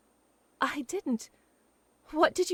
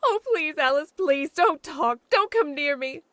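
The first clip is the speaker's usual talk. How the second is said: louder, higher, pushing her away; anguished dismissal; 3 semitones higher, 7 dB louder than usual. Acted. Jilted woman to her friend Alice, anguished. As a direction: beg to be left alone, near breaking.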